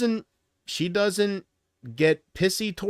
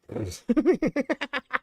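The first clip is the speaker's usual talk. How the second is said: high, drawn-out giggle; tittering laugh that keeps going; real giggling; 9 semitones higher than usual. Real, not acted. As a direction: break into giggles at yourself. Laughs at own joke.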